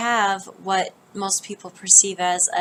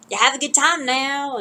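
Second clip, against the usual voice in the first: mock Texan twang, pitched up; Texan accent; 6 semitones higher than usual. Imagining how Texans sound, mock Texan accent. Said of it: "whatever Texans sound like".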